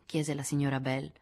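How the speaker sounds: ordinary delivery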